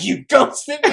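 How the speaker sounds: loud, high accusation with a laugh; hammed-up loud imitation of an accuser; confrontational mock yell. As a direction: exaggerate the angry call-out, laughing. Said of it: "I didn't say it like that".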